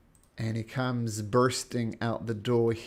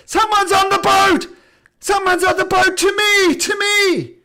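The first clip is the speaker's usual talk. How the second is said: alarm shout; 15 dB louder than usual. Acted. Guard alerts the ship.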